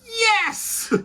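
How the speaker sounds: high triumphant yelp of victory; triumphant cry. Pretend. Doom cheering his own award, shrill triumphant cry. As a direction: crow with delight at winning.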